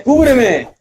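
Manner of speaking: high urgent repeated yell; cover me shout again. Real, not acted. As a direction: call for cover, still pressed. Gaming, calling teammates for cover.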